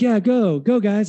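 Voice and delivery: timid first try at cheering the workers on; half-hearted cheer. Retold or imitated. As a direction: cheer them on without much effort. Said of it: "No, really give it to him".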